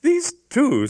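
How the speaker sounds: put-on high, pert girl voice; young woman. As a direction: point them out scornfully. Hypatia accuses.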